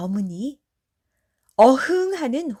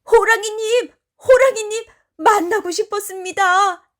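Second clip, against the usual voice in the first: high, loud, eager shout; glad calling out; 10 semitones higher, 6 dB louder than usual. A narrator's reading. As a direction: call out to the tiger in delight. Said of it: "소리치는 게 아니겠어?". (반갑게 높이 소리치기)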